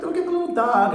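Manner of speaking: high, loud cutesy voice; mock the salesman's sweet talk about the dog; high-pitched mock of the salesman's baby talk; mimicking the salesman. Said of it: "he's like, like".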